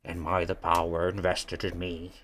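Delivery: low, slow muffled voice behind a door; muffled through a door. Acted. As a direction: chant it as if heard through wood. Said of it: "you hear a muffled little voice".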